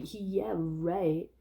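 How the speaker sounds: imitated brush-off from passing girls; mock dismissive. Girls passing by dismiss Davy, mock dismissive. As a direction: toss it off, lightly scornful. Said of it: "they say it like that".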